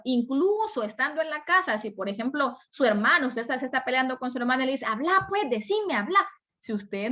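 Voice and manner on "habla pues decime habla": higher-pitched pestering sibling; mock quarrel, push them to talk; insistent demand. Arguing sibling demanding an answer, insistent.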